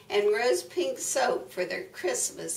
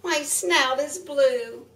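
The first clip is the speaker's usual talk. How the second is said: high pig-like whine; lightly voiced pig, pitched up; squealing pig; 6 semitones higher, 4 dB louder than usual. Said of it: "squealed the pig".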